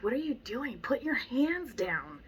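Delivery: a trainer scolding a student holding the leash wrong; exasperated trainer. Retold or imitated. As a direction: fuss at them in disbelief. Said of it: "I'm like".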